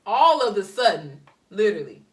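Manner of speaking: loud, laughing all of the sudden. Real, not acted. Repeats phrase through laughter.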